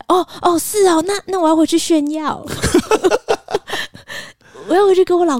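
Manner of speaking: pretending to be thrilled at being hit on; mock delighted. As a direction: squeal it like a flattered girl. Flattered, teasing reaction.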